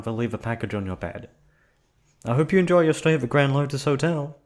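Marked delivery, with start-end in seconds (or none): 2.2-4.4 higher, louder chirpy hotel greeting; beaming, over-eager receptionist farewell; bright sign-off. Acted. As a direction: sell the welcome with a big smile.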